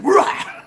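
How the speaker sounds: loud, high imitated bark; dog's attack bark. Imitating Charity going at the goose, explosive bark. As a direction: burst out like a lunging dog. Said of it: "that's when Charity said".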